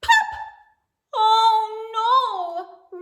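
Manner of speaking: plain reading voice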